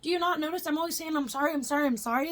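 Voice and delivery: high, rushed frightened okays; imitating her scared self agreeing; scared compliance. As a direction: babble agreement out of fear. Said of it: "I'm like".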